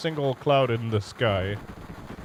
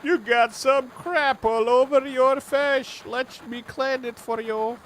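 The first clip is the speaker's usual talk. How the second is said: higher, slow goofy voice; high character voice; 10 semitones higher than usual. Acted. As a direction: play a fussy helper. Voicing character.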